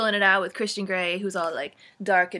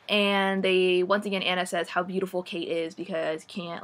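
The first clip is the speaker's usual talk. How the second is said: ordinary talk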